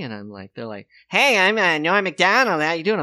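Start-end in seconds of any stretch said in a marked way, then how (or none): 1.1-3.0 loud, high, overdone caricature; bad Norm Macdonald impression. Fan walks up to the comedian doing his voice, loud high caricature. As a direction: blare it like an overeager impressionist. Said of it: "they do impressions of me".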